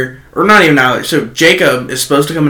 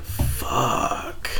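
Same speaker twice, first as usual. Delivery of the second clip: soft, voiceless curse under the breath; hushed dismay; 3 semitones lower, 13 dB quieter than usual. Retold or imitated. Dismayed reaction to confirmed gossip.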